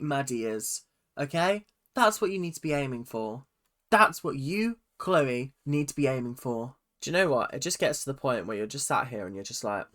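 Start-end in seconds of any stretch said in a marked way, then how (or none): none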